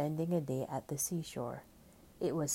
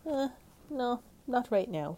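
hesitant refusal; 9 semitones higher than usual. A narrator's reading. Hesitant child refusing to come in.

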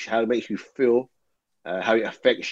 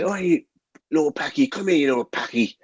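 put-on a light, higher-pitched Irish accent, friendly old neighbour; imitating Irish accent; 5 semitones higher than usual. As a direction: mimic an old Irishman calling you over in his Irish accent. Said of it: "in his Irish accent".

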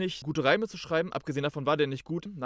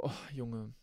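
softer, thinking to himself; softer private thought about the rival's message; quiet inner thought; 3 semitones lower, 8 dB quieter than usual. Retold or imitated. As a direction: say it under your breath, to yourself. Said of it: "Und ich denk mir so".

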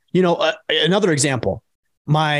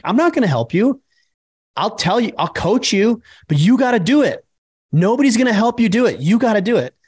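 raised, higher-pitched insistent father; tough-love pep talk, pitched up; firm coaching dad; 7 semitones higher than usual. Retold or imitated. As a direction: push a child to do it on their own. Father coaching daughter in retold scene.

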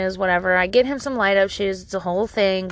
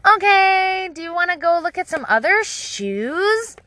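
high singsong offers; higher, over-sweet parent voice; coaxing a toddler; 8 semitones higher, slower than usual. Retold or imitated. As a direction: tempt a small child, pitched up and drawn out.

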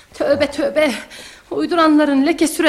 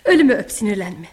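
low, pleading promise; placating; 6 semitones lower than usual. Acted. Mother placates her angry son. (alttan alan, yalvaran)

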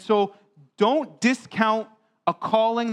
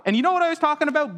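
ordinary delivery

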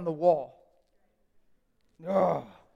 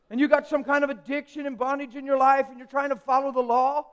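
ordinary talk

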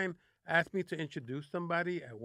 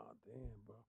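hushed retreat; 6 semitones lower, 21 dB quieter than usual. Retold or imitated. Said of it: "And I go".

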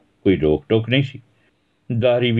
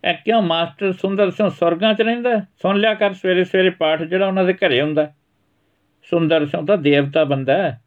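put-on much higher child's voice, sassy; cheeky boy answering back; 11 semitones higher than usual. Son answering back to his mother, cheeky. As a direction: talk back to mum like a smart kid.

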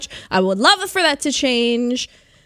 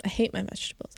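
whispering; 2 semitones lower, 11 dB quieter, faster than usual. Real, not acted. Whispered confession.

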